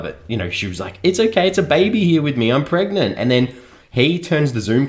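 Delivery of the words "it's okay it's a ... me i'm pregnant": high, loud mock-sweet wife voice; hammed-up high cheerful announcement; mocking wife quote. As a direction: parody her cheery reveal, pitched way up. Mock quoting wife announcing pregnancy.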